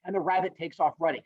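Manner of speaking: plain reading voice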